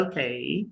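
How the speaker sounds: ओके with gliding "au" and aspirated K; British OK. Shown as a demonstration. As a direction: say ओके the English way, "au-khay". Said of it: "अंग्रेज क्या कहते हैं?".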